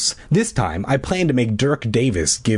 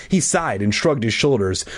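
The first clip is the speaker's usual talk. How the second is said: plain reading voice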